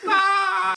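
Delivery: high loud startled shriek; shocked shriek. Real, not acted. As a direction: let out a sudden high yelp. Shocked reaction to dog video.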